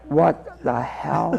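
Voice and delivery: low, soft, very slow; stunned disbelief. Retold or imitated. Rookie police pull into the lot, stunned and hushed. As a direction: let it out slowly under your breath. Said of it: "looking like".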